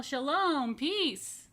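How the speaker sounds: called out high and loud in greeting; a neighbor waving and calling across. A narrator's reading. Yafa greets Fatima, calling out cheerfully. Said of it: "she would wave and call".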